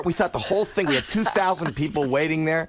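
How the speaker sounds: usual speaking voice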